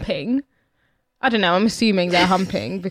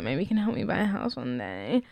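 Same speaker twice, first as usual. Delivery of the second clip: low, soft re-enacted flirt; timid little chat-up line, kept low and quiet; understated flirting; awkward flirting; 7 semitones lower, 10 dB quieter than usual. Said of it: "my little flirting was like".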